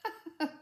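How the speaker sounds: light amused laugh; girl's laugh. Acted. Girl laughs at the pixie's blanket mistake. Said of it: "Millicent Mary laughed".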